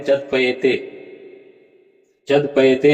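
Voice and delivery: "जद पयेते" run together as one word, wrongly joined; wrong reading demo. Shown as a demonstration. Teacher showing students the common mistaken reading, demo of wrong joining. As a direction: show the mistake: "जद पयेते" said jad-payete. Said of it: "ऐसा उच्चारण करते हैं".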